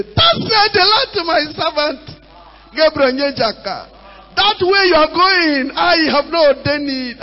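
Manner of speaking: high, shouted mock oracle, slow; mock prophet. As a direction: boom it like a fake prophet declaring doom. Preacher mocks false prophecy to member.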